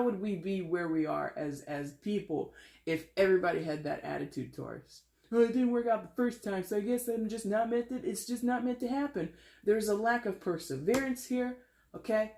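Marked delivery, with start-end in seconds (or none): 5.3-9.3 high-pitched mimicry of someone giving up; higher-voiced, making fun of a quitter's excuses; mock defeatist. Mimics viewers who give up.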